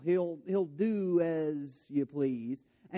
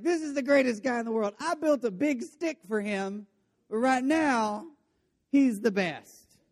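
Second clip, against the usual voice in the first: higher, hurried, through-the-teeth praise of "greatest"; grudging praise; 6 semitones higher, 4 dB louder than usual. Retold or imitated. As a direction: force out "This is the greatest guy in the world" like it hurts. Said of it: "Which is hilarious".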